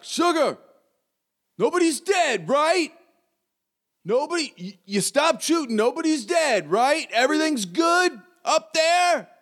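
high, strained call up the stairs. Acted. Same voice from below checks on Sugar, calling out high and strained. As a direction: holler upward, pitch pushed way up. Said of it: "It's a little louder".

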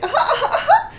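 high squealing giggles; hammed-up delighted squeal. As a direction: giggle like an overjoyed pony. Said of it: "finally it's just like".

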